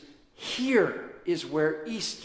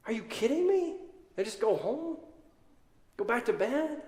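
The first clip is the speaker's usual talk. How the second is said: much higher, exasperated question; disbelief; 7 semitones higher than usual. Acted. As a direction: throw it up in disbelief. Preacher outraged at disciples.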